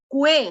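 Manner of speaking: "Cue" drawn out as kweh; wrong pronunciation. Shown as a demonstration. Teacher teasing students with the wrong reading, "Cue" said as kweh. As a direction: sound the u in "Cue", slowly. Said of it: "Yo no digo cue".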